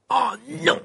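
high-pitched cry of dismay; dismayed exclamation. A narrator's reading. Ron reading the headline, exclaiming in dismay. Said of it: "s'exclama-t-il".

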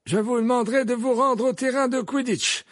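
formal announcement. A narrator's reading. Dumbledore addressing the school, ringing and slow. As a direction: announce it to the whole hall.

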